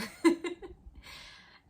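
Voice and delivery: high airy cackle after calling Mom; loud high laugh. Real, not acted. Telling a story about an old bully, bursts into loud laughter.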